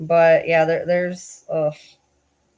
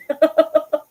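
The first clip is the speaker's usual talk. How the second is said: laugh; 9 semitones higher, 5 dB louder than usual. Real, not acted. Laughs after singing.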